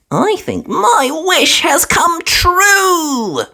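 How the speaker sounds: put-on high, slow little girl voice; a small piggy girl, dreamy and hopeful; squeaky young piglet, slowly; Peppa's voice. A narrator's reading. Peppa speaking in story.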